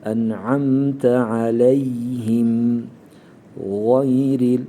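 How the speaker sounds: slow chanted En'amte aleyhim; slow recitation. Shown as a demonstration. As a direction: recite it long and melodic. Recitation demo.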